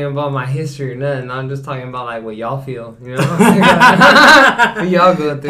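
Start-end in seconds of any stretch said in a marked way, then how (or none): 3.3-4.7 high, loud belly laugh; loud laughter. Real, not acted. Joker explaining what y'all feel, loud laughter. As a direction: burst out laughing mid-explanation.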